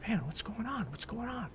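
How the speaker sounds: high, softened voice, baffled; hushed bewilderment. Retold or imitated. Pianist to himself as the band falls apart, hushed bewilderment.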